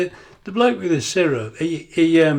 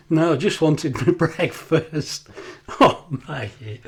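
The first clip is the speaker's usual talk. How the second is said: punchline giggled out slowly; laughing through the punchline; cracking up at his own story; 2 semitones higher, 4 dB louder, slower than usual. Real, not acted.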